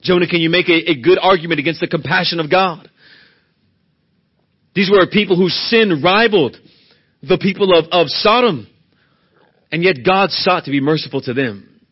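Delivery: loud, rising preacher's cadence; preaching at full force. Acted. Preacher pressing the congregation on God's mercy, preaching hard. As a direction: drive every line home to the pews.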